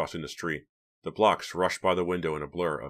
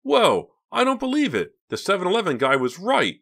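very high, loud exclamations; amused, pitched-up outburst; giddy astonishment; 14 semitones higher, 7 dB louder than usual. A narrator's reading. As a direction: exclaim it in gleeful disbelief. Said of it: "Mickey said, shaking with laughter".

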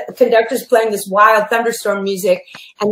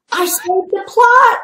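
indignant; 9 semitones higher than usual. Retold or imitated. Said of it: "I said".

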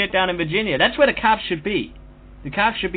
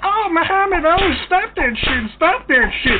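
high, loud whining plea; mock pleading Steve; 9 semitones higher, 4 dB louder, slower than usual. Imagined Steve begging Muhammad to stop, in a high mock wail. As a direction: squeal it like a hammed-up victim begging. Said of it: "Steve gonna be like".

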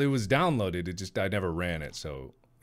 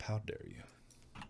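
whispered; 14 dB quieter, slower than usual. Pretend. Mock outrage ends hushed.

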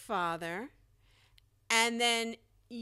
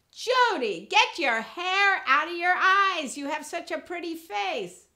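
higher, louder nagging mother, softening on the praise; mother act-out; 6 semitones higher, 5 dB louder than usual. Shown as a demonstration. Mother telling Judy to fix her hair, high and insistent. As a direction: become Mom calling out to her daughter. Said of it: "My mother said to me".

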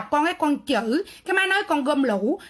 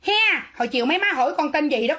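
ordinary delivery

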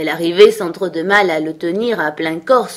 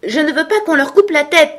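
high, loud refusal; forceful, high-voiced exclamation; exclaimed protest; 10 semitones higher, 5 dB louder than usual. A narrator's reading. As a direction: protest hotly at a raised pitch. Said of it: "s'exclama-t-elle".

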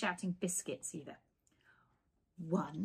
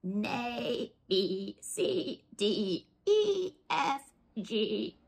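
building chanted alphabet, louder; horses reciting; 5 dB louder, much slower than usual. Acted. The horses showing off their alphabet, building. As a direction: recite it proudly, getting louder.

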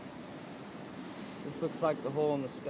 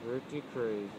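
soft, mumbled round a cigar; cigar in mouth; 5 semitones lower, 7 dB quieter than usual. Real, not acted. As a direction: mutter it with a cigar between lips. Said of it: "I have a cigar in my mouth".

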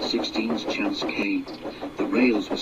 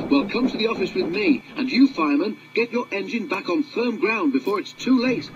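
higher foreman giving orders; ordering foreman; 8 semitones higher than usual. Acted. Foreman ordering driver and fireman, higher commanding voice. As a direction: command the crew firmly. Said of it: "ordered the foreman".

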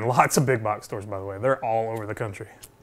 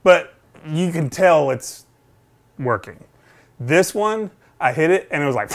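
normal talking voice

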